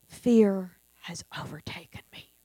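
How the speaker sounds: hushed, breathy whisper of confession; a voiceless whispered prayer; fearful whisper. Retold or imitated. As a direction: whisper it like a frightened admission. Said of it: "is going".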